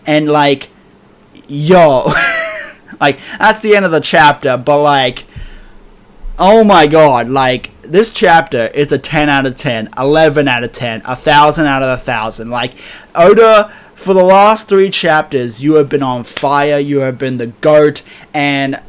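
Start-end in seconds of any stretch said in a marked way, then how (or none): 2.2-2.7 very high, joyful squeal of laughter; ecstatic laughing shriek. Real, not acted. Hyped at chapter's end. 13.1-15.6 louder, higher hyped shout-out; excited praise. Real, not acted. Fan praising the author.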